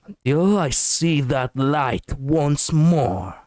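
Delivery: slow, menacing line read; villainous. Pretend. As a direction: make it sound like a villain. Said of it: "that was kind of villainous".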